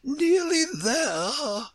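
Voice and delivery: high, very slow regal echo; Queen echoing. A narrator's reading. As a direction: repeat it back incredulously, vowels stretched. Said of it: "the Queen repeated".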